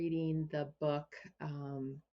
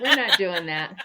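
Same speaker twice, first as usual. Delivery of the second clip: laughing mock universe; 12 dB louder than usual.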